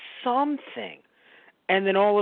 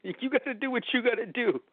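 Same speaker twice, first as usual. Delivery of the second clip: a higher mock pep talk; encouraging cheer, higher; 6 semitones higher than usual. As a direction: cheer him on, pitched up. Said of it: "I'm like".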